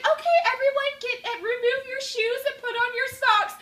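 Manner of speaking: put-on high foreign-accented class instructor; imitated foreign accent. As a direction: address the class, mimicking her accent up high. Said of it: "She has this accent".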